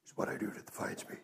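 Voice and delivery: low, fast breathy Batman rasp; a hushed, raspy Batman impression; gruff Batman voice. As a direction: growl it out like Batman. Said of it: "he said it in a gruff voice".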